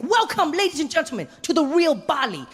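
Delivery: very high, bubbly guide greeting; overeager tour guide. Retold or imitated. Tour guide greets sleepy tourists, very high and bubbly. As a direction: gush like a way too happy guide. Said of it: "he's like".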